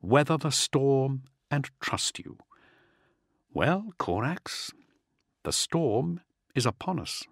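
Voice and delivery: slow soliloquy with long pauses; muttered to himself. A narrator's reading. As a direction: weigh each phrase, talking to yourself. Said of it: "Valerius muttered to himself".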